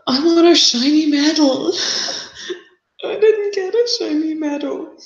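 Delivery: put-on very high, childish whine; mock whingeing.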